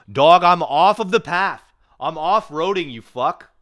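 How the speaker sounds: high, loud exasperated retort; indignant protest. Acted. Walker telling the helper he's already off-roading, loud and indignant. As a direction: push it out loud, comically fed up.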